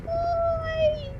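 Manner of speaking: squeaky voice. Pretend. Squeaky why.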